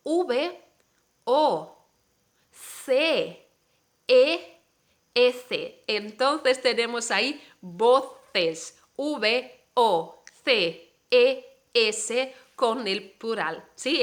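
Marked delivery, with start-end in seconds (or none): 0.1-5.8 each letter on its own, with a pause after it; spelling letter by letter. Shown as a demonstration. Teacher spelling a word for Spanish learners, letter by letter. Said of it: "Voy a deletrear la palabra". 9.0-12.4 slow, separated letter names; spelling letter by letter. Shown as a demonstration. Teacher repeating a spelling for learners, letter by letter. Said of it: "Deletrear es decir letra por letra".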